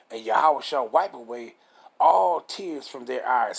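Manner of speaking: forceful verse reading, leaning on "all"; emphatic, deliberate preacher's reading; scripture reading. Real, not acted.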